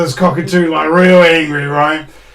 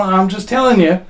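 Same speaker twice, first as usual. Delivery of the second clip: ordinary talk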